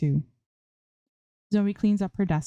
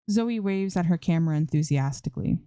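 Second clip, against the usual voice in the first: plain reading voice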